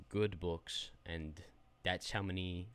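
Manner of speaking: ordinary talk, nothing marked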